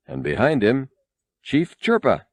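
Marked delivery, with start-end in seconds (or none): none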